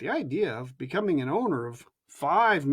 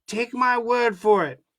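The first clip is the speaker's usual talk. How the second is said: ordinary delivery, nothing marked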